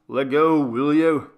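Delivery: father yanked out of sleep, snapping at his son; irritated father shouting at his screaming son; irritable father. Acted. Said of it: "my father said irritably".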